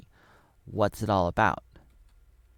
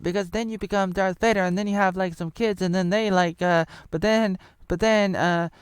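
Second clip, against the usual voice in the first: high mock voice; 8 semitones higher, 3 dB louder than usual. Voicing a young Anakin's future.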